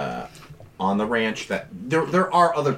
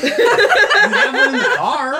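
laughing; 8 dB louder, slower than usual. Real, not acted. Laughs at player's question.

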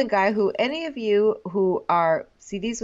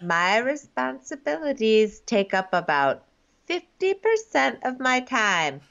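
slow, spelled-out mock hint; deliberately slow imitation of a coy mom; 5 semitones higher, slower than usual. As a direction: drag it out as a heavy-handed clue.

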